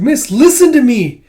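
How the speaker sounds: high, loud, slow plea; urgent shout. Retold or imitated. Kippa shouting to Miss in the dream, loud slow plea. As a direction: shout slowly to be heard.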